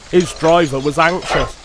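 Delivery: plain reading voice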